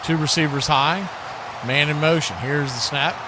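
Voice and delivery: ordinary talk, nothing marked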